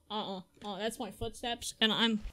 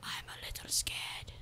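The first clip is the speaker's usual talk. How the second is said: hushed, breathy whisper; barely-there nervous whisper; scared whisper; 12 semitones lower, 10 dB quieter than usual. Real, not acted. Creeping scared in horror game.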